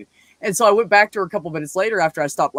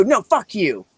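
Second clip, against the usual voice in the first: higher-pitched mock anger; retold pissed-off ex; 6 semitones higher, slower than usual. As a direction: play an angry girlfriend, lightly. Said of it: "And she'd go".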